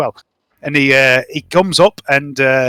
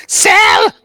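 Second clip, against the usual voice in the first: creature cry; 3 dB louder than usual. Acted. Sel upset cries.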